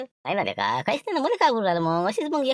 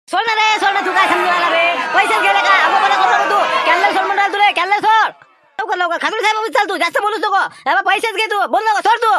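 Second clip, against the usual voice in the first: loud, higher heated shouting over money; staged angry quarrel; 8 semitones higher, 7 dB louder than usual. Acted. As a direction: yell like you're about to grab his collar. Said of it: "जसं केलं नाटक".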